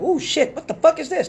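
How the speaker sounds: high, laughing recoil from the smell; disgusted surprise. Retold or imitated. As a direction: squeal it while pulling back from the cup. Smelling the Hennessy.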